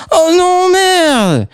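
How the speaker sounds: high squealed curse; high girl's cry. Acted. Girl slipping on a stone, high squeal. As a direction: squeal it as she slips.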